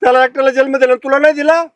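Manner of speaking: loud, high-pitched rebuke; angry shout. Acted. Woman argues the father is his too, angry shout. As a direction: hurl the question at him. Quarrel over neglected father.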